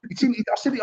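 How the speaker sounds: ordinary talk, nothing marked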